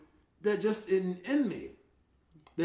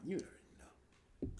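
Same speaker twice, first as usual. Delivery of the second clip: normal talking voice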